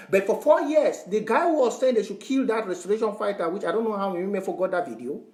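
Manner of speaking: normal talking voice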